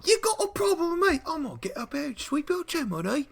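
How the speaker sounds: put-on very high little goblin with an English accent; goblin chimney sweep. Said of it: "little britches, and he's just like".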